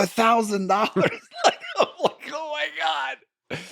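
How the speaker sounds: laughing disbelief. Retold or imitated. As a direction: crack up at the price. Laughing at the thousand-dollar ask.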